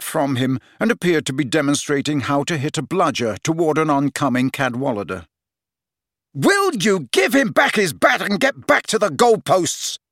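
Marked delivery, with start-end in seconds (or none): 6.3-10.0 angry roar. A narrator's reading. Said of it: "roared Harry".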